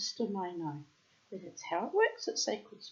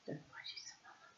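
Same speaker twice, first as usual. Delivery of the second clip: much softer, breathy aside; whisper; 18 dB quieter than usual. Real, not acted. Reader quietly telling the bird off, whispered. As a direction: hush it to the bird mid-reading.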